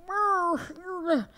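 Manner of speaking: a high brr, like a cold shiver. Retold or imitated. Wife reacting to husband's ad spending with a high shivering brr. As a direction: shudder at the ad bill.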